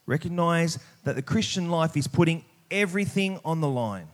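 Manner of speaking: ordinary delivery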